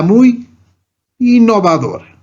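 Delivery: mock-admiring, ironic lift on the word. Shown as a demonstration. Teacher showing irony in an example sentence, ironic. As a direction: say it as if you don't mean it. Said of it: "con un sentido irónico".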